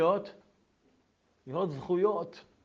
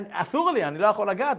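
higher, louder refusal; 7 semitones higher, 7 dB louder than usual. Retold or imitated. The officer refusing to touch cash, higher and loud. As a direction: wave the money away.

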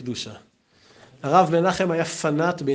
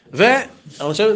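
ordinary talk, nothing marked

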